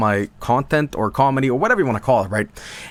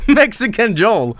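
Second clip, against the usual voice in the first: laughing; 8 semitones higher, 3 dB louder, slower than usual. Real, not acted. Laughing at the comment.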